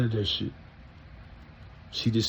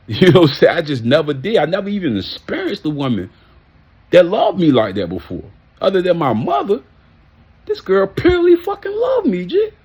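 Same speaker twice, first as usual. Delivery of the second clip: higher, louder, fervent insistence; impassioned; 9 semitones higher, 5 dB louder than usual. Real, not acted. Man raving about the woman who loved him, impassioned. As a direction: get carried away praising her, voice rising high. Fervent praise of a past girlfriend.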